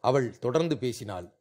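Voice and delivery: ordinary delivery, nothing marked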